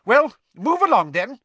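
high, loud, brisk order; McGonagall, sharp. A narrator's reading. Teacher moves the bedraggled crowd along, sharply. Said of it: "said Professor McGonagall sharply".

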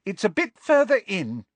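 high-pitched call over the shoulder. A narrator's reading. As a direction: call back to someone behind you. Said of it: "calling loudly over her shoulder".

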